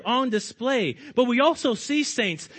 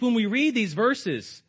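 normal talking voice

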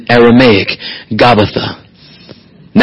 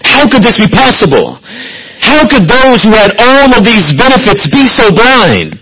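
higher, pleading preacher questions; preaching at full force; 8 semitones higher than usual. Acted. Preacher asks the congregation, higher fervent pleading. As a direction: press the question on the hearers.

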